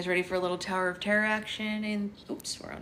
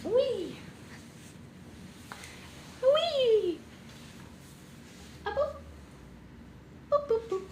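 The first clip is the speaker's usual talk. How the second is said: much higher, squealing play noises; playful squeals; slower than usual. Real, not acted. Playing with baby.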